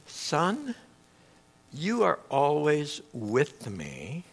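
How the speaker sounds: calm his angry son tenderly; father answering the older son, quiet and slow; gentle father. Retold or imitated. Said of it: "what the father says".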